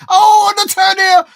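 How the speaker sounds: loud, high burst of excitement; excited shout. Real, not acted. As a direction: jump out of the seat with the chance. Sudden reaction to a chance.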